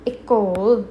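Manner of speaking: a firm, careful École; French word modelled. Shown as a demonstration. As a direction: model École clearly for learners. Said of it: "How we going to pronounce".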